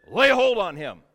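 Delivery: loud royal order; commanding shout. Acted. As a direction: shout it as a king ordering an arrest. King orders prophet seized.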